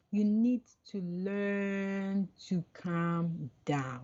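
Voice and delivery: very slow, drawn-out calm words from a sister; slow it right down as if calming someone; slow calming advice. Retold or imitated. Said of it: "she said".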